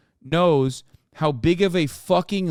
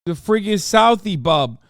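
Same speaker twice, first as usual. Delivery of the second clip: louder, higher mock Southie tough-guy voice; Boston accent; 5 semitones higher, 6 dB louder than usual. Mocking Ben Affleck in a louder, higher Boston accent. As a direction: do a Boston bro.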